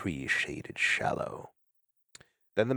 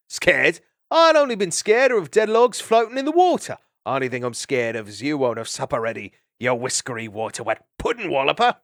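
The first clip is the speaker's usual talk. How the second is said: jovial insult shout; 5 semitones higher, 7 dB louder than usual. Acted.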